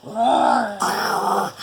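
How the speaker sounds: playful imitated lion roar; drawn-out pretend roar; lion's roar. A narrator's reading. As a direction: roar like a lion in a picture book. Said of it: "says lion".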